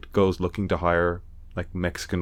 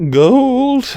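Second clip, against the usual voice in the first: jumps way up high and loud on gold; excited squeal; 10 dB louder than usual. Real, not acted. Praising the sisters' comedy to a friend, squealing gold with delight.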